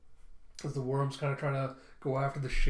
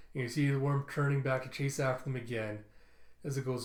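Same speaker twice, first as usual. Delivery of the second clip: ordinary delivery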